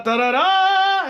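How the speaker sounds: very high, slow, drawn-out "da, da, da"; mocking singsong. Reviewer mocking the film's dull speech, high sarcastic singsong. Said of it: "I was like".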